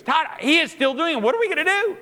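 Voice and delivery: high, loud mock panic; frantic wife. Wife waking husband over crying baby, frantic. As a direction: wail it, voice shooting up in alarm.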